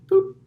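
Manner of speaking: very high, loud little "boop"; imitated phone beep. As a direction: squeak out a phone alert.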